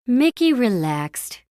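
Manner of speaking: ordinary delivery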